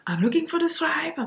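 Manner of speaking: high confident ask for the swipe; self-assured. Retold or imitated. To coworkers, a confident high ask for the swipe. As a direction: sure of yourself, and wrong. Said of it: "j'étais persuadée".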